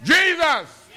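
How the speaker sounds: high shouted cry of "Jesus!"; crying out loud. Shown as a demonstration. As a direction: call out "Jesus!" high and full voice. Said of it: "you need to make it known".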